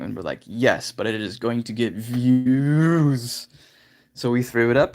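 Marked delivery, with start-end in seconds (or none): 0.4-3.5 slow, with VIEWS stretched way out; mock eager retort. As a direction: drag out views like a gleeful sales pitch. Acted retort to disgusted mom.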